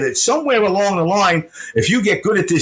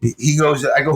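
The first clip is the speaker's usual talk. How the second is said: usual speaking voice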